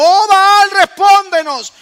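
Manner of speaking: high, loud desperate plea to Baal; prophets of Baal crying out. Retold or imitated. Preacher voicing Baal's prophets begging their god. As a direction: cry out to an idol that never answers.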